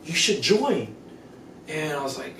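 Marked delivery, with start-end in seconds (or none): 0.1-0.9 higher-pitched, eager invitation; pitched up, coaxing enthusiasm; excited friend. Retold or imitated. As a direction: imitate an excited friend recruiting someone. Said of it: "she got excited".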